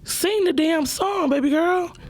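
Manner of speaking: high, slow, drawn-out sassy call to the singer; mock scolding. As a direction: stretch it out like you're heckling from the crowd. Scolding the girl on stage.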